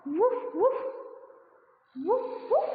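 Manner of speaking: high playful woof; dog bark. Shown as a demonstration. Teacher shows kids how a dog barks. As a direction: bark like a puppy.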